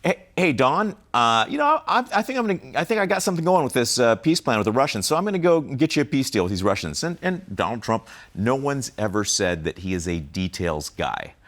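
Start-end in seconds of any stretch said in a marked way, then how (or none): 0.0-7.0 higher, louder, eager buddy pitch; imitating Witkoff. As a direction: sell the boss on the deal, all excited. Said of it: "he says".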